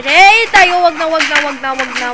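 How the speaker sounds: loud, rising begging; loud pleading. Real, not acted. Streamer begging teammates not to do it, loudly. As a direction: plead loudly, near panic.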